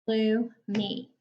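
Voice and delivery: "Gloomy" in two separate beats, gloo, mee; split into syllables. Shown as a demonstration. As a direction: pause between the syllables of "Gloomy". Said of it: "count the syllables in the word".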